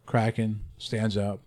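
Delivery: ordinary talk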